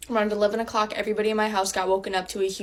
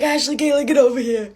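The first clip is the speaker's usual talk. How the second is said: loud, higher call down the hall; calling out names; 5 semitones higher, 7 dB louder than usual. Retold or imitated. Dad calling his daughters from his room, loud. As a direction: summon the kids from another room.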